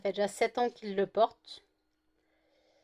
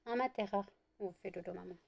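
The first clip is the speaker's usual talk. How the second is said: whispering over a crib; 10 dB quieter than usual. Real, not acted. Cooing to sleepy puppy.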